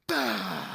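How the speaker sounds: loud, high mock grunt of effort; straining gym grunt. Pastor acting out the gym guy again, hammed-up grunt. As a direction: blurt a hammed-up flexing grunt. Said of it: "He did it".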